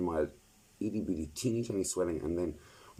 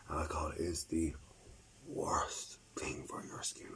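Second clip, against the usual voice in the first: whispering; 6 dB quieter, slower than usual. Real, not acted. Confides alcohol wrecks skin.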